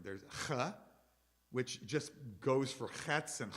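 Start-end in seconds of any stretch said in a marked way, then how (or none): 0.2-0.7 ch as a scraped back-of-throat fricative; guttural ch. Shown as a demonstration. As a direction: demonstrate ch as in German "Bach". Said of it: "consonants that are different".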